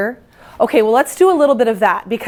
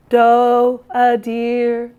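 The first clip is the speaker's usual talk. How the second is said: normal talking voice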